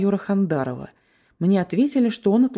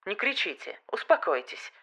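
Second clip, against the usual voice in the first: тихо, но жёстко и холодно; приказать ледяным тоном; стальным голосом; 3 semitones higher, 8 dB quieter than usual. A narrator's reading. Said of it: "стальным голосом приказал Хандаров". (стальной голос майора)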